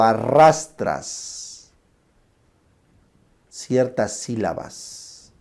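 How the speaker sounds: slow, stretched-out syllables; teacher demonstrating dragged speech; dragging syllables. Shown as a demonstration. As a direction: drag each syllable out slowly. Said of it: "estoy arrastrando".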